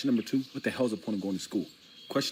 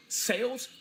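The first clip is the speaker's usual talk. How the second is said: exasperated yelp; 14 semitones higher, slower than usual. Real, not acted. Desperate outburst at job idea.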